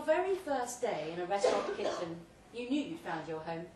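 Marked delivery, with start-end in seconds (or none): none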